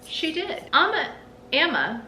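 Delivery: ordinary delivery, nothing marked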